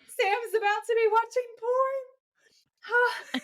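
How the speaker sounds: crying with laughter, high and whispery; breathless laughing squeal. Real, not acted. Rereading her notes to her co-host, laughing high and breathless. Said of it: "And I'm, like, dying laughing".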